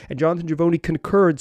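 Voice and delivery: ordinary talk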